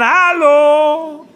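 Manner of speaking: high, loud hello; shouted. Retold or imitated. Wife shouting into the dead line, loud. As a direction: shout into the ether. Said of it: "schreit sie den Äther an".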